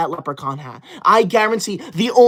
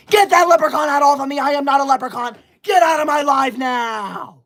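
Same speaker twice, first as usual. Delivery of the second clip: mock furious shout; 6 semitones higher than usual. Streamer shouting at chat over the hat, mock furious. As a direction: shout the troll out of the live.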